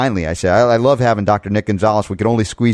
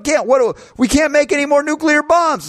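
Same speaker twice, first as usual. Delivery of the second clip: much higher, squeaky mock voice; mocking Wolf Blitzer. Host mocks Wolf Blitzer on CNN, high squeaky parody. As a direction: lampoon him as a panicked squeaker. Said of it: "Wolf Blitzer's going".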